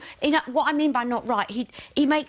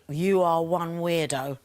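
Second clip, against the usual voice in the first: blunt mock local; 6 semitones lower, slower than usual. Housemate voicing locals' verdict on a creepy compliment, low and slow. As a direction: lower and slow, flat put-down. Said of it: "They would say".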